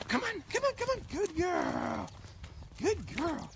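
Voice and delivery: very high squeaky coaxing voice; sing-song high call to a dog; high-pitched dog praise. Real, not acted.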